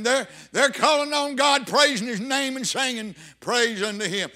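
full-force preaching. Acted. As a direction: preach it hard, driving each phrase home.

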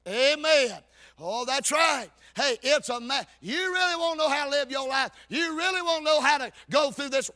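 loud, high-pitched preacher's cry; impassioned preaching. Real, not acted. Preaches at full force.

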